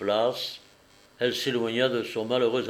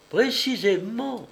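high, drawn-out, piqued confirmation; slightly piqued; 10 semitones higher, slower than usual. A narrator's reading. Madame du Manoir robbed of her story's climax, slightly piqued. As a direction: say it high and slow, a little put out. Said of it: "un peu piquée de se voir enlever".

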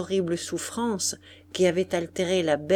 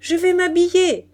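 high, loud call from bed; shouted announcement; 10 semitones higher, 8 dB louder than usual. A narrator's reading. Daughter shouts after her departing mother, calling loudly. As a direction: keep calling out at full pitch. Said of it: "cria Moïna".

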